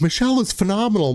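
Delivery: imitating the client's excited gush. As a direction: relay her thrilled rave. Client gushing on phone after party.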